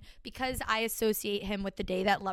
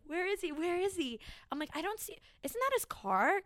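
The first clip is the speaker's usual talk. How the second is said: higher, eager excited search; giddy excitement; 7 semitones higher than usual. Retold or imitated. Cousins at the window watching the boy leave, giddy. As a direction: squeal it like a giddy teenager. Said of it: "looking heads out the window".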